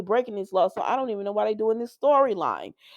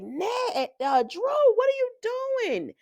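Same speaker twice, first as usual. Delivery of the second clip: high, shocked why-are-you-doing-that; voicing a stunned onlooker; shocked question; 13 semitones higher, slower than usual. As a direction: act out the shock of the people watching. Retold shocked question to Drew.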